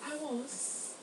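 soft, high, badly faked British accent; horrible British accent. Claiming a British past life, bad British accent. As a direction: put on a hushed fake British voice. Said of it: "Let me stop with that horrible accent".

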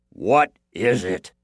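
loud, slow giant; giant character voice. A narrator's reading. Giant questions the princess in bed, booming slowly.

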